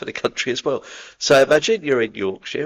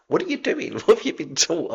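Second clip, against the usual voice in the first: high, laughing what are you doing; laughing disbelief; 11 semitones higher than usual. Retold or imitated. A man scolding his own spiritual community, laughing. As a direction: laugh through the question in disbelief. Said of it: "I think, like".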